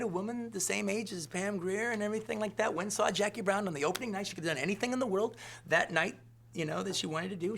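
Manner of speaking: normal talking voice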